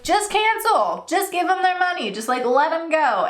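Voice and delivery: husband urging her to let the customer go, coaxing high voice; husband's pleading. Retold or imitated. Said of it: "My husband's always like".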